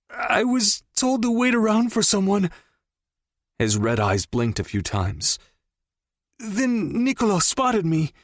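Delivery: high, strained confession; pained pleading. Acted. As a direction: plead through the pain. Captive confesses in pain.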